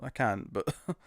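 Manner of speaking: ordinary delivery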